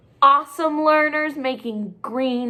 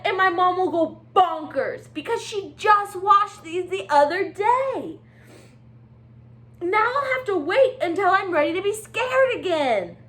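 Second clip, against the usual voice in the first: bright, chatty penguin voice with hiccup fuss; excitable Little Penguin; 9 semitones higher than usual. Acted. Teacher reading aloud as Little Penguin to her class. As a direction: play the fretful little penguin.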